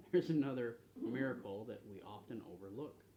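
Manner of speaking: normal talking voice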